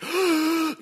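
sudden high gasp of alarm; retold shocked gasp; sharp gasp. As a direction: catch your breath in fright. Sees police lights in mirror.

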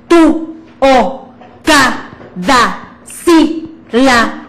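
each syllable punched separately; syllabic reading. Shown as a demonstration. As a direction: strike every syllable apart from the diaphragm. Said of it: "leer cada sílaba como si la tuviéramos acentuada".

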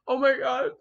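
loud shocked outburst; a real gasp of disbelief. Real, not acted. Finding the shocking fanfic.

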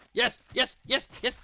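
high, loud repeated yes; triumphant yelling. Real, not acted. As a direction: cheer the win loudly. Game success.

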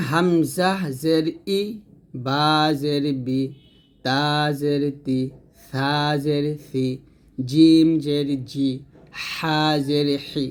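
slow, syllable by syllable; slow drill recitation. Shown as a demonstration. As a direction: spell each letter slowly for students. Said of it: "teacher drills letters slowly".

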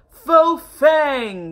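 loud squeaky shout. Acted. Mice shouting the last words of the song. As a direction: belt it out like the mice. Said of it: "learned to shout loudly".

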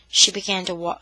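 usual speaking voice

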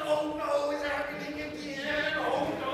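high, loud panicked cry; a boy scared it's happening again; high frightened yelp of oh no. Acted. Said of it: "he's like".

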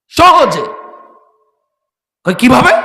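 high, loud rhetorical cry; loud exclamation. Real, not acted. Preacher rousing the crowd, loud rhetorical cry. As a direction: call out to rouse them.

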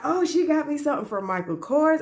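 high, thrilled retold gasp of joy; squealing with excitement over the gift; excited. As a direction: replay the moment she saw the brand. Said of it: "I was so excited 'cause I was like".

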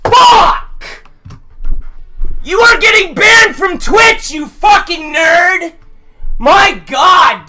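angry yelling at a player; raging gamer. Real, not acted. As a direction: shout in fury.

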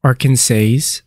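Arkansas ending in "says"; wrong pronunciation. Shown as a demonstration. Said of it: "It is not Arkansas".

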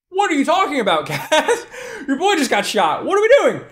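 high-pitched incredulity, breaking into laughs; mock freak-out through laughter; incredulous panic. Gang member to Kaz after the shooting, panicked and incredulous. Voices the alarmed reaction to Kaz.

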